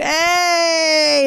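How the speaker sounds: high shouted "Hey!" with a clap; clapping cheer. Shown as a demonstration. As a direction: greet like a party. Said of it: "then you go".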